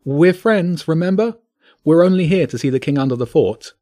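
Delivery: high, nervous reassurance; shaky. A narrator's reading. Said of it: "James said shakily".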